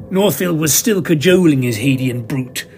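plain reading voice

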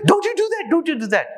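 very high, panicked shrieking; mock scream of the wife. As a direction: shriek it at the husband in terror. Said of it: "She's screaming away".

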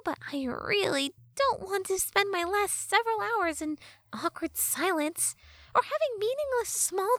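high-pitched sassy fairy; Tattle fairy voice. Acted. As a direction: play a cheeky fairy girl, high.

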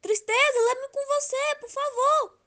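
high, loud begging; desperate plea. Acted. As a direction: plead urgently. Love begging Sadness for help.

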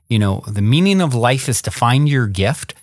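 ordinary talk, nothing marked

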